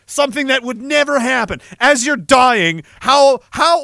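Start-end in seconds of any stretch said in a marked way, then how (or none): none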